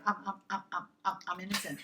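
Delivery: soft, halting stammer on "I'm"; stuttering. Retold or imitated. Employee answering her boss, stuttering timidly. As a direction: stumble over the first word repeatedly. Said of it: "I'm, like, stuttering".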